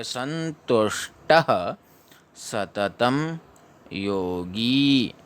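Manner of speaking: संतुष्टः सततं योगी very slowly, word by word; teacher recites word by word; slow recitation. Shown as a demonstration. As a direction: drill each word slowly. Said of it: "ऐसे पढ़ेंगे".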